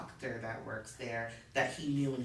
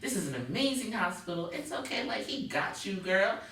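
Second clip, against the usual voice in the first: higher comforting nurse voice; reassuring nurse; 9 semitones higher, 4 dB louder than usual. Acted. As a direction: soothe her like a patient. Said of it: "I'm telling her".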